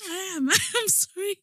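high, weak apology through giggles; crying with laughter. Real, not acted. Telling her friend she is sorry, tearful with laughter. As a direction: insist you're sorry while laughing. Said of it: "Now, now you're crying".

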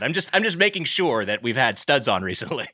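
usual speaking voice

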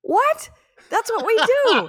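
very high-pitched disbelief; incredulous protest. Real, not acted. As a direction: protest in amazement, pitch shooting up. Astonished at the short podcast.

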